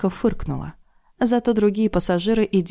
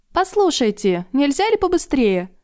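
playful call; 8 semitones higher than usual. A narrator's reading. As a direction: call cheekily to the driver. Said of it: "задорно покричала Люсинда водителю".

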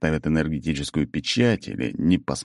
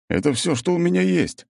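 high character voice; 12 semitones higher than usual. A narrator's reading. Character answers, voiced higher.

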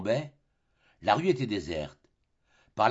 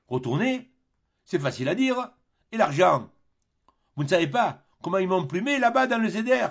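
higher, louder protest; indignant Tartarin; 8 semitones higher, 5 dB louder than usual. Acted. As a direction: complain about being fleeced. Tartarin complains.

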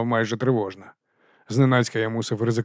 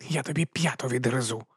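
usual speaking voice

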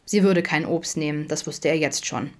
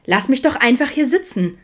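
put-on high, loud defiant teen; whiny, combative teen retort; provocative snapping; 8 semitones higher, 6 dB louder than usual. A narrator's reading. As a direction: snap it to provoke. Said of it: "fauchte sie provokant".

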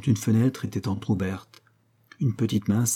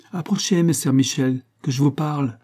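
raised, higher call across the water; hailing him from the prow with raised voice; 5 semitones higher, 4 dB louder than usual. A narrator's reading. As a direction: call him over from the boat, higher and louder. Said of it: "cria la Dolfina".

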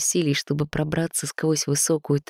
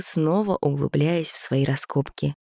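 plain reading voice

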